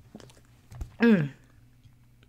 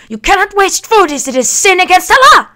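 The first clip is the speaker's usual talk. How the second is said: high, loud scolding lecture; mock nanny scolding a child; 9 semitones higher, 6 dB louder, much faster than usual. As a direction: scold like the nanny in your head. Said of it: "I hear Nanny's voice".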